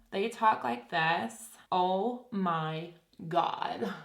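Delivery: slow, drawn-out Valley girl impression; Valley girl accent. Shown as a demonstration. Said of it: "I can do a Valley girl".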